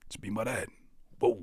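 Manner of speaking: low, soft, lightly voiced terse talk; mock cool one-word voice. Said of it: "You gotta say things like".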